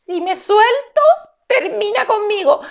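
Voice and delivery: high little mongoose voice, strained; squeaky small animal clinging on; teeth-clenched high squeak; Rikitiki's high voice. Acted. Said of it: "la pobre Rikitiki dijo".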